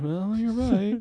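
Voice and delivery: high, nervous McFly voice; hammed-up nerdy McFly; George McFly impression. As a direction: squeak it like timid George McFly. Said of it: "George McFly from Back to the Future".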